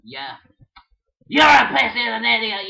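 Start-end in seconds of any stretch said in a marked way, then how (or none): 1.3-2.7 loud yelled insult; play-fight yell; angry shout. Pretend. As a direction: shout down the yellow bird. Toy quarrel with bird.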